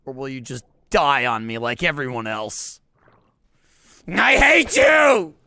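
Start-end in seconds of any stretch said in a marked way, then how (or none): 4.1-5.3 louder, drawn-out slow shout; yelling at the squids in mock rage; mock furious yell. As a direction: stretch out each word in a hammy scream.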